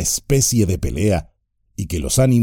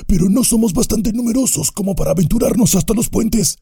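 shrill orc objection; another orc; faster than usual. A narrator's reading. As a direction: another goblin arguing. Orc continues.

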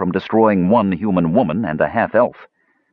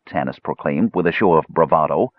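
ordinary delivery

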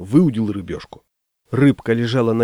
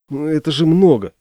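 higher, louder hesitant protest; замявшись; 5 semitones higher, 5 dB louder than usual. A narrator's reading. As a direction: balk a little, hedging. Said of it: "замялся я". (замявшись, неуверенно)

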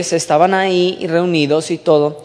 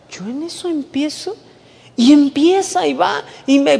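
ordinary talk

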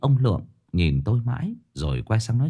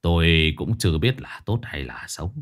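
put-on stern old master; 5 semitones lower than usual. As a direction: command the workers calmly from low in the chest. Master tells grandfather about boy. (giọng thầy pháp trầm, chậm rãi)

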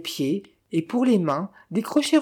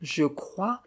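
ordinary delivery

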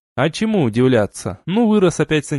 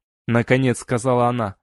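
ordinary delivery, nothing marked

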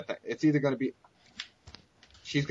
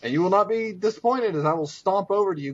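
ordinary talk

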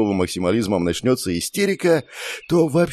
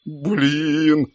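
high, stretched-out exclamation; crying out; 6 semitones higher than usual. A narrator's reading. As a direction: cry out in alarm. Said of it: "закричал я".

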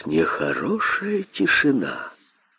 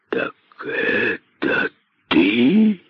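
very slow, drawn-out hiss; hissing python; much slower than usual. A narrator's reading. Great python finally addresses Косой, slow hissing. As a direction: hiss each word out slowly. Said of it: "наконец прошипел великий питон".

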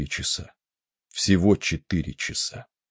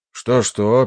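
asking back; 5 semitones higher, 5 dB louder than usual. A narrator's reading. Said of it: "переспросил Райли".

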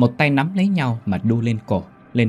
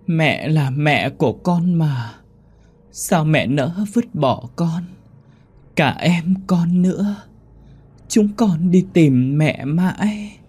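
put-on high, slow, reproachful child's voice; ghost child pleading; 7 semitones higher, much slower than usual. A narrator's reading. Child ghost reproaching the mother who left it. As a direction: drag every word out like a sad little ghost.

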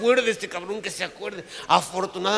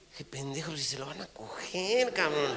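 muttered self-scolding; 7 dB quieter than usual. Retold or imitated. Inner self-scolding aside.

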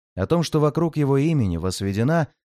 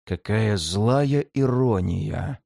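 slow, low hoarse scoff; 5 semitones lower, much slower than usual. Acted. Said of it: "сипло хмыкнул Ворган".

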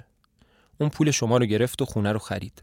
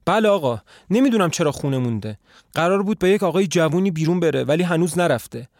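flustered maid; 5 semitones higher, 4 dB louder than usual. A narrator's reading.

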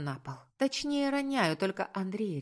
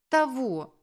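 high, loud one-word snap; snappish retort; 7 semitones higher, 7 dB louder than usual. A narrator's reading. Upset woman to the man questioning her, snapping back. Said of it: "Вдруг огрызается она".

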